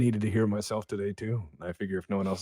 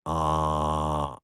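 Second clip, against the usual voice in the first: ordinary talk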